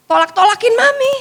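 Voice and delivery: high, loud burst of mimed rejection; playful loud imitation of a teenager's refusal; mock pushing away. Acting out teenager pushing mom away.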